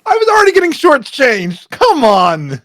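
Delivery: high, loud indignant protest; mock outrage. Gnome co-host protesting his share of pay, high and loud. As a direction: squeal it like you were robbed.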